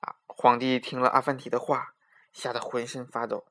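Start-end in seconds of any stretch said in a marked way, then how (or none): none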